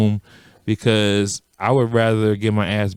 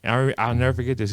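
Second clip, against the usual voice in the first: ordinary talk, nothing marked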